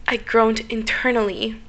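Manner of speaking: normal talking voice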